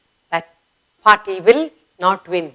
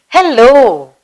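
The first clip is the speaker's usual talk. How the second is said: louder hello; calling out; 3 semitones higher, 4 dB louder than usual. Shown as a demonstration. Teacher calling out to someone. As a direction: shout it across the distance. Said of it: "or shouting out to someone".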